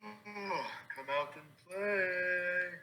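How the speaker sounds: high, soft, drawn-out singsong call; зазывающий оклик. Acted. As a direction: coax them out to play, stretched and quiet. Player calls out to the halfling.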